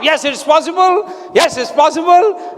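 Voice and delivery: rallying chant of conviction; fists-up conviction. Shown as a demonstration. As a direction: shout it with both fists clenched. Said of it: "make two fists like this".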